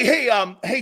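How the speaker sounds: ordinary delivery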